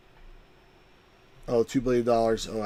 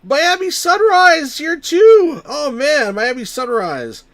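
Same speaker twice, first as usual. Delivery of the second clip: high, loud, stretched-out cheer; real high-pitched excited yell; excited shout; 12 dB louder, much slower than usual. Real, not acted. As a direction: whoop a big welcome, easing off by the end. Greets an arriving viewer with delight.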